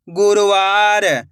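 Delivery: slow, drawn-out blending of the word; slow clear reading. Shown as a demonstration. Teacher to pupils, blending the word slowly. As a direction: stretch each syllable for the pupils. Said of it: "मैं किस तरीके से एक एक अक्षर का उच्चारण".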